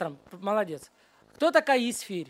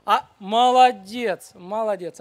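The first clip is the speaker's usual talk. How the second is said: loud, slowly stretched praise; drawn-out praise; 4 dB louder, slower than usual. Real, not acted. Teacher to a child who got a hard question, drawn-out praise.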